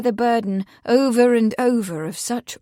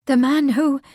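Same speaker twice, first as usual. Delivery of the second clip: higher, gasped half-question; 5 semitones higher, 4 dB louder than usual. A narrator's reading. Bea realises who the dead man is, gasping. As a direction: break off in shocked recognition. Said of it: "Bea gasped".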